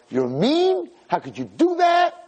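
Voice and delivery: high-pitched indignant scolding; mock outrage. Listeners emailing the rabbi about a goldfish, high mock outrage. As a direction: scold like an appalled complainer.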